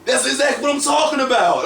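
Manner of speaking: high, hammed-up exclamation through laughter; excited approval. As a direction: mimic a thrilled coach, cracking up. Acts out excited trainer, laughing.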